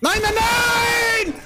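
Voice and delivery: drawn-out high yelled no; panicked howl of despair; panicking gamer. Real, not acted. Gamer dying in match.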